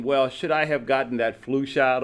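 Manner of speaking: ordinary delivery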